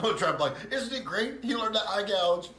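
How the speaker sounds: loud, high gleeful boast; laughing shout. Acted. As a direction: crow over the chaos, laughing. Laughing, shouting proudly over the chaos.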